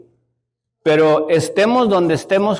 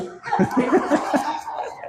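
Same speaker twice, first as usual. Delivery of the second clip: soft airy laugh at himself; high breathy chuckle; a quiet wheezing laugh; breathless laugh; 8 semitones higher, 7 dB quieter than usual. Real, not acted.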